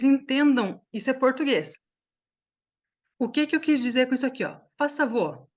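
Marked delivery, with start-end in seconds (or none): 4.8-5.5 "Faz favor" blended into "fasavô"; casual linked "Faz favor", as in everyday speech; run-together speech. Shown as a demonstration. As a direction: slur "Faz favor" into one word, sounds dropped. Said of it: "Vocês viram como a gente fala assim?".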